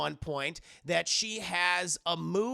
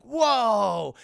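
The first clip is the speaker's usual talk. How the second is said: loud, drawn-out whoa; amazed crowd; 4 semitones higher, 10 dB louder than usual. Retold or imitated. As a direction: gasp in awe like spectators. Crowd amazed by skateboard trick.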